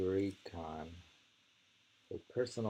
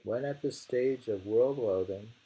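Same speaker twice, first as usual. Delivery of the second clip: recital growing louder toward the end; poem reading building; 4 semitones higher, 4 dB louder than usual. Acted. Rereading the verse to a friend, growing louder. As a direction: lean harder into the last lines.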